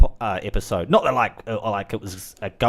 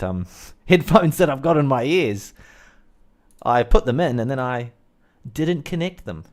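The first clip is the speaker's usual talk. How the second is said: ordinary talk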